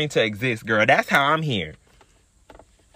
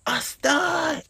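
high squeal of disbelief; shocked outcry; 13 semitones higher than usual. Real, not acted. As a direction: shriek it out, scandalized. Shocked reveal repeated.

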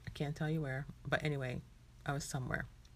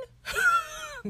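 laughing squeal, much higher; squealed laugh of disbelief; 5 dB louder than usual. Real, not acted. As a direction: burst out in a high shriek of laughter. Disbelief at a war with the Queen.

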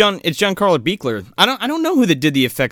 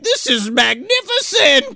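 high, slow mock triumph; exulting mad scientist; 14 semitones higher than usual. Doctor watching driftwood get chomped, hammed-up exulting mad scientist. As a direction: stretch it out like a gloating villain.

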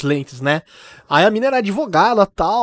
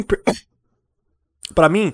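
coughing through crumbs, slowed; choking on chips; slower than usual. Real, not acted. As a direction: choke on a crumb mid-sentence. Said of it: "engasguei com farofa de Doritos".

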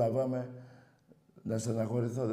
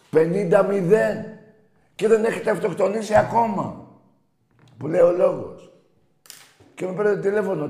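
real on-air outburst, much higher and louder; furious shout; 8 semitones higher, 8 dB louder than usual. Real, not acted. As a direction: yell it at the rival fans. Host ranting at rival callers.

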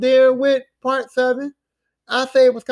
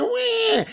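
high-pitched mock baby wail; imitated baby cry. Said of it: "She's back crying".

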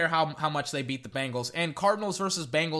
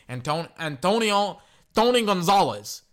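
ordinary delivery

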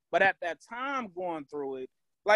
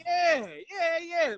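laughing yeah; 10 semitones higher, much slower than usual. Real, not acted. Laughing after mock fight.

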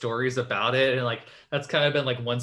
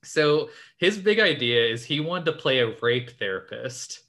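ordinary talk, nothing marked

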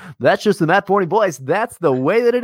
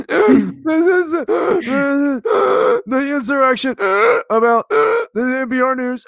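higher, mocking public radio newsreader; mock NPR announcer; 8 semitones higher than usual. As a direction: parody a halting NPR host. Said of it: "You sound like a fucking N P R".

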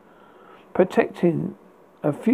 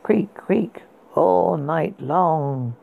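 plain reading voice

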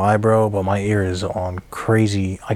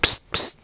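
unvoiced psst to call a cat; whispered cat call; 4 dB quieter than usual. Real, not acted. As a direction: hiss softly to get the cat's attention. Calling the cat over.

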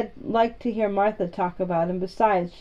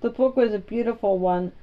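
plain reading voice

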